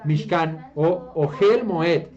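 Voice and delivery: "Mishkan o Ohel Moed" said slowly, each Hebrew syllable spelled out; careful slow demo of "Mishkan o Ohel Moed"; Hebrew pronunciation. Shown as a demonstration. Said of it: "¿Cómo se dice tabernáculo en hebreo?".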